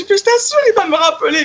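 high, excited pleading, laughing; frantic insisting. Retold or imitated. To her boyfriend on the phone, pleading that Delon will call back, high and excited. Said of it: "Mais je".

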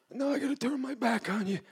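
imitated movie mobster, pitched very high; Paul Sorvino. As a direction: quote a film boss casting someone off. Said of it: "Goodfellas with Paul Sorvino and Ray Liotta".

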